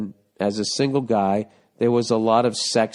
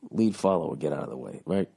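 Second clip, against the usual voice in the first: quiet aside; 9 semitones lower, 7 dB quieter than usual. Real, not acted. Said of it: "Here we go".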